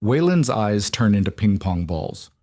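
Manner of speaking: ordinary delivery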